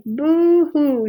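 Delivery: loud, high fake sob; mocking boo-hoo. A narrator's reading. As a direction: taunt the crying rat. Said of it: "shouted Botticelli".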